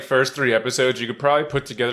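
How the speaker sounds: normal talking voice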